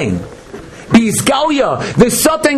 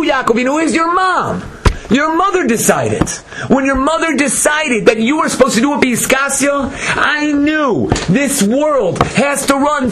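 higher, insistent wife telling him; a hint of Leah, pitched up and firm; lightly voiced Leah; 8 semitones higher than usual. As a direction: press the point as Leah. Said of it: "Said Leah".

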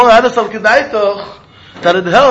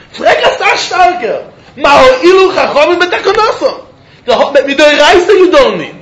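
higher, louder excited lecturing; 5 semitones higher, 4 dB louder than usual. Real, not acted. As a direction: build up to the big kasha. Builds to the stronger question.

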